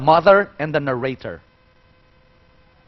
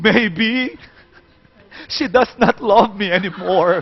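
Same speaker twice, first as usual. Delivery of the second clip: overdramatic crying; 4 semitones higher than usual. Shown as a demonstration. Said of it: "It's too dramatic for children".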